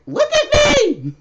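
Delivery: very high, loud, slow squawking bird voice; desperate bird voice. Retold or imitated. Voicing the stranded bird crying out to her, squawking. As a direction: squawk it like a frantic bird begging attention.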